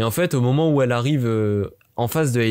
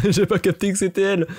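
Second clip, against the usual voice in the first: laughs through it, pitch jumps higher; laughing; 7 semitones higher than usual. Real, not acted. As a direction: laugh at your own slowness. Rit d'elle-même, surprise. (en riant de sa propre distraction)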